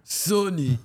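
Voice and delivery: lightly voiced old man; a hint of grandpa. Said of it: "old man voice".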